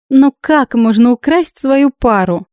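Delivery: high, slow artless question; feigned innocence. A narrator's reading. Bride keeping up her act before judges, feigned innocence.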